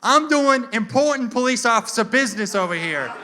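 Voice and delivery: high, self-important cop yell; imitated cop's loud indignation; indignant cop. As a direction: bellow it like an offended officer. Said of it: "he storms up to my car".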